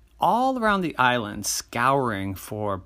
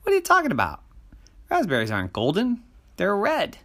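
incredulous; 9 semitones higher, faster than usual. Acted. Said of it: "said Jerry".

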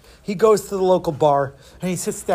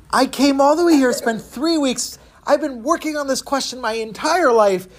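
indignant complaint; 7 semitones higher than usual. Acted. Man complains bitterly at the bar.

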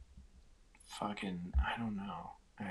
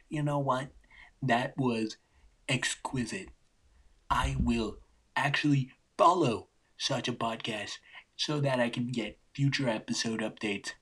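a lightly voiced, higher, posh-sounding fan gushing; imagined fan; 3 semitones higher, 4 dB louder than usual. Podcaster mocking an imagined listener, lightly hammed-up gushing. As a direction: play a gushing podcast fan, a bit louder. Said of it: "actually says".

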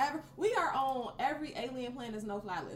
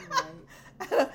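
laughing; 7 semitones higher, 7 dB louder than usual. Real, not acted. Laughing at the Errol mix-up.

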